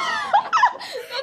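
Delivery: very high shriek of laughter; laughing. Real, not acted. Guesser gives up on the phrase, laughing. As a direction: burst out laughing.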